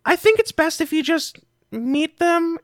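high-pitched little creature voice; Griddle. Acted. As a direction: speak up high as a cave guide.

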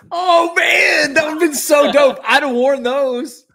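loud, high excited outburst; excited shout. Real, not acted. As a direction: shout it with delight. Excited about custom shoes.

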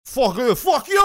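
loud, high accented outburst; hammed-up gangster yell, rising on the repeat; Tony Montana. Shown as a demonstration. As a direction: shout it as a cartel gangster impression. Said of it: "Tony Montana voice".

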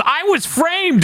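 high, drawn-out cry of protest; mock yell. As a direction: yell it slowly and high, as Fred to the crowd. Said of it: "Fred yells at the crowd".

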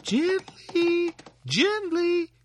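very high, loud calls; little Tom calling. Acted. Tom from the horse's ear calling to slow down, very high. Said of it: "Tom was calling out".

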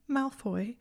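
high, tearful call of his name; sniffling. A narrator's reading. Hermione sniffling, trying to talk to Malfoy. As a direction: sniff and call him gently.

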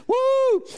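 high loud joyful woo; whoop. Real, not acted. Preacher whooping to the congregation, loud woo. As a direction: let out a shout of joy.

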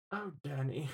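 soft, hushed mock teacher sigh; imitating teacher. Student recalling teacher's sigh at his paper, soft mock voice. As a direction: breathe his name like a disappointed teacher. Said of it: "she was like".

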